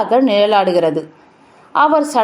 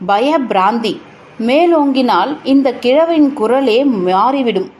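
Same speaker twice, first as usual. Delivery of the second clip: usual speaking voice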